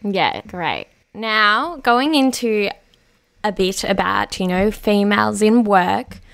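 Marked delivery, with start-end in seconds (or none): none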